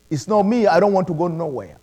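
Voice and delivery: usual speaking voice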